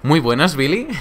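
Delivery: hesitant hello, lilting up like a question; high, questioning imitation of a greeting; doubtful greeting. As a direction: mimic someone unsure as they say hi. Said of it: "Como dudando ya directamente".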